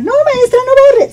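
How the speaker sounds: very high, squeaky mock-pupil voice; imitated high-pitched student plea; pleading pupils. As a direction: whine like schoolkids begging the teacher. Mimics pupils pleading not to erase.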